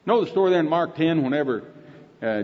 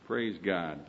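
ordinary delivery

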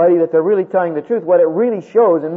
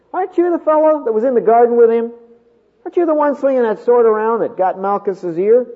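a higher, louder challenging servant; accusing servant; 8 semitones higher, 4 dB louder than usual. Acted. Servant of the high priest confronts Peter, higher and louder. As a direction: corner him with the question.

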